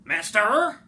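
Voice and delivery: high, loud sharp summons; the Lorax pops out of the stump, calling loudly; Lorax's bossy call. Acted. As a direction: bark it out like a bossy little creature. Lorax calls out, read aloud.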